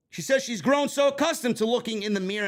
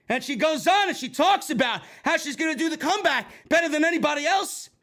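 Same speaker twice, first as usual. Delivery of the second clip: ordinary delivery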